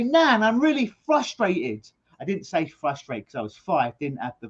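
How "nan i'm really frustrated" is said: put-on high, slow whiny kid voice; higher, louder childish complaint; young child complaining. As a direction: whine it up to Nan like a frustrated five-year-old. Five-year-old complaining to his nan.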